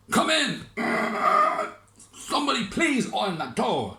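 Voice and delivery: high, loud fussy mayor calling out; a grand mayor barking at the door; pompous mayor. Acted. Mayor calling a visitor through a creaky door, high and fussy.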